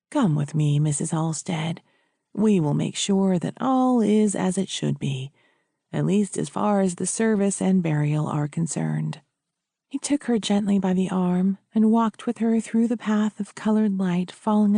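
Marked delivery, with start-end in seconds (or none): 0.1-9.2 lower-pitched, composed priest. Acted. Priest taking charge of burial for widow, low and composed. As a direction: guide them as the parish priest.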